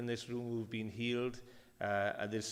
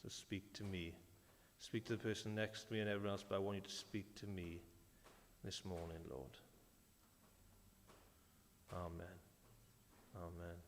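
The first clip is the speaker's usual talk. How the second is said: hushed, softer prayer; quiet prayer; 3 semitones lower, 12 dB quieter than usual. Real, not acted. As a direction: pray softly before the church. Pastor prays aloud.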